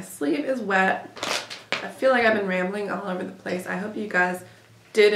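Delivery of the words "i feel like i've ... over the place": normal talking voice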